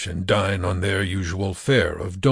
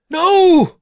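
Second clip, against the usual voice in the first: very high, loud scream; desperate shriek; 8 dB louder than usual. A narrator's reading. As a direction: shriek it out to stop him. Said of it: "Diane shrieked".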